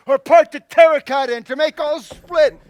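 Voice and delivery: bellowing, slow Hercules rant; lofty ranting tyrant. Acted. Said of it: "This was lofty".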